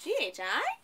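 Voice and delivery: "G H I" asked with a high questioning rise; rising question tune. Shown as a demonstration. Teacher shows a question reading: rising tune. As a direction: lift the end of "G H I" like a real question. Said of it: "'Cause I'm asking a question".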